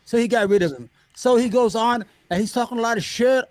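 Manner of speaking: ordinary talk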